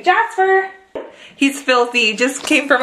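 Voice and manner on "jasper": high call across the room; calling the dog. Real, not acted. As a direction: call his name to bring him over.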